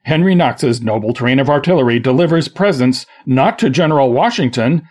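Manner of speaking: upbeat radio ad voice; ad read. Acted. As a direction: sell it like a commercial. Mock radio ad.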